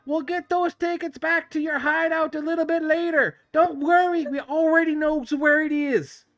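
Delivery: sneering street thug calling back; taunting thug. Acted. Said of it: "and you hear, uh, coming back from them".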